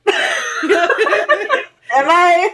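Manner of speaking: high, laughing self-question; amused disbelief; laughing doubt. Retold or imitated. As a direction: giggle the question at yourself. Laughing self-doubt in a retold scene.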